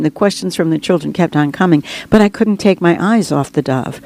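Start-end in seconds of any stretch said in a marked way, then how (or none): none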